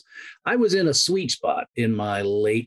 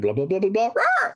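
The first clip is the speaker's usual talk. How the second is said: higher alien chatter ending in a squawk; alien bird voice; 10 semitones higher than usual. Retold or imitated. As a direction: babble like a bird, then squawk. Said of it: "I went".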